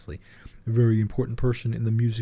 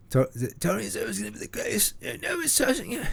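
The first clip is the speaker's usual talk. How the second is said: much higher, put-on [name] voice; [name] impression; 13 semitones higher than usual. Doing [name] for his co-host, high and put-on. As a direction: squeak it out like [name]. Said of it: "just do your [name] impression".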